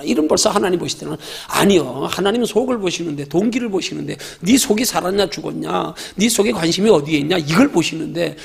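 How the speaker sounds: usual speaking voice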